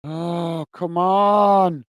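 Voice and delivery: exasperated groan of a plea; frustrated. Real, not acted. Streamer waiting on a website that won't load, frustrated. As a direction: drag out a fed-up "come on", rising then falling. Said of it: "I get frustrated with my computer".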